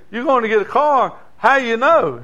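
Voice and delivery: loud, high disbelieving question; doubting preacher. Acted. Preacher questioning the boy, loud and disbelieving. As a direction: doubt every word the boy says.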